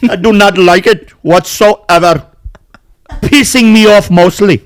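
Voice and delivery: exaggerated Indian accent impression. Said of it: "this is my fourth Indian different accent".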